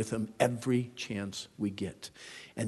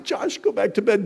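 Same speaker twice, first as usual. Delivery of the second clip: sleepy dad; 9 semitones higher, 5 dB louder than usual. Retold or imitated. Sleepy dad answering son.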